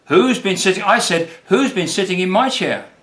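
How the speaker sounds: a hammed-up Papa Bear howl; mild howl. A narrator's reading. Papa Bear finding his chair used, mock howl. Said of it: "Papa Bear howled".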